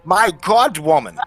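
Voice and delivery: loud, appalled outburst; horrified shout building to the end; outraged accusation. Acted. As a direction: accuse her at full voice, pitch leaping up. Outraged cross-examination of the mother.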